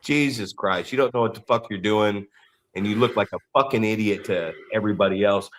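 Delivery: low, soft muttering of disgust under the breath; quietly seethe at the idiot; muttered contempt. Retold or imitated. Comedian in locker room muttering about a rookie, low disgust. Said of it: "looking at this fucking turd burglar going".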